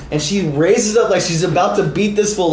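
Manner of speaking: normal talking voice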